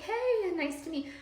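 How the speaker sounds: very high, sugary greeting; imitated eager kindness. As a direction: act out trying hard to be nice. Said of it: "tried my hardest to be kind".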